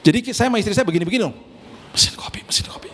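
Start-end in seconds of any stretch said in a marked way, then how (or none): 1.9-2.9 hushed whisper between spouses. Retold or imitated. Husband whispering to wife about coffee machine, hushed. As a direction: whisper it so the host can't hear. Couple whispering at sister's house.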